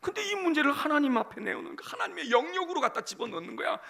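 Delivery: ordinary delivery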